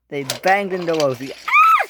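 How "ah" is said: short piercing high shriek; very high, loud squeal; scream. Acted. Rosie is banged into, piercing shriek. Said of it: "she screamed".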